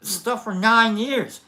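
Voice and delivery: mock Cypher, building from whiny to a loud crying shout; Cypher rant. Retelling Cypher's Matrix complaint, imitating him building to a crying shout. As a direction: ham up a traitor's complaint until it breaks into a wail. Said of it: "He's like".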